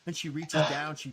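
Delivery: usual speaking voice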